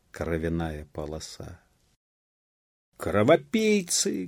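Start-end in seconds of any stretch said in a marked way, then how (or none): 3.0-4.3 high, drawn-out cry of accusation; outraged shout. A narrator's reading. Peasants rush at the guards, outraged shout. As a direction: cry it out, stretching the word. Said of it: "кричали мужики".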